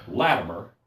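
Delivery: name dropped low with a falling tune; falling call to self. Retold or imitated. Latimer warns himself before the king, low falling call. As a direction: set your own name down firmly. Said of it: "I'm thinking".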